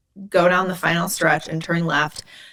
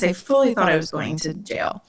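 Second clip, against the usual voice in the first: ordinary talk, nothing marked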